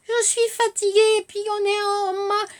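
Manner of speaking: put-on high, whiny little girl voice; little girl Alice. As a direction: play a tired little girl. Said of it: "vous entendez Alice, la petite fille". (voix aiguë de petite fille fatiguée)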